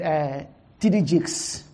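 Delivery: ordinary talk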